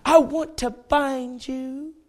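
high, very slow coaxing; Delilah. Acted. Delilah telling Samson her intent, slow and coaxing. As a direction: draw it out sweetly in a put-on lady's voice. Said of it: "When she said".

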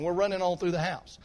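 ordinary talk, nothing marked

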